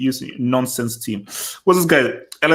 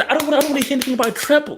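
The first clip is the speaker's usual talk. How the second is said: 1 mocking quote; 13 semitones higher, much faster than usual. Mock-quoting rival fan.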